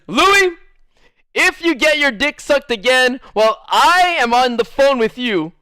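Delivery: high, loud mock ultimatum; scolding girlfriend. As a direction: lay down the law as an exasperated girlfriend. Voicing girlfriend's ultimatum to him.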